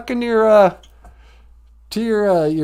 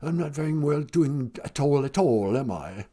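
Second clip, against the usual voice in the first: Harry; 7 semitones lower, 9 dB quieter than usual. Acted.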